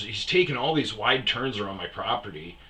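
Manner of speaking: ordinary talk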